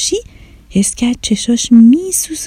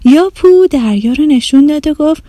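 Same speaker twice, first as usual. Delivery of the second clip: ordinary delivery, nothing marked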